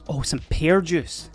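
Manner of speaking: ordinary talk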